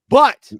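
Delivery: BUT punched loud and high; BUT as a big dramatic pivot; emphatic turn. Real, not acted. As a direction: hit a single loud, high BUT, then stop. Dramatic turn in his story.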